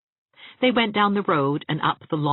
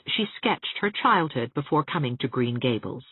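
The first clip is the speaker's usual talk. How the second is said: plain reading voice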